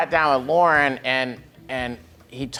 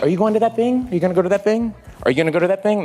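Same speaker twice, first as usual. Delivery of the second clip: lightly voiced, higher, insistent nagging; a hint of Kristen pestering, pitched up; imitating Kristen Wiig; 7 semitones higher, much faster than usual. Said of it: "then she's like".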